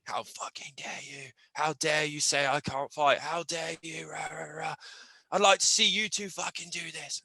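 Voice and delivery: hushed imitation of an outraged fighter; half-whispered mock outrage; mock aggressive. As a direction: act out the indignant confrontation under your breath. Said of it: "You've come over being really aggressive".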